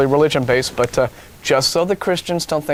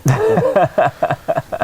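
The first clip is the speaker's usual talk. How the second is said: real laugh; 6 semitones higher, 4 dB louder than usual. Real, not acted. Laughing at the movie jab.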